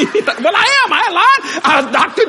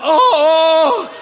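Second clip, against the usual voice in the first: crying oh; 3 semitones higher than usual. Retold or imitated. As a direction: cry out as strength returns. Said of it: "he went like".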